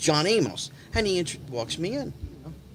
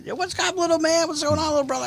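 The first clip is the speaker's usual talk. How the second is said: much higher, upbeat phone hello; high-pitched imitated greeting; 13 semitones higher than usual. Older friend answering the late-night phone call, high imitated greeting. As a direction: greet a little brother, voice pitched way up.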